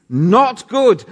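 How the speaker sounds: high and loud, like a shocked ad voice; dramatic announcement. Acted. Preacher dramatising God's verdict, shocked and loud. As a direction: deliver it like a dramatic shock line. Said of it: "we draw in our breath".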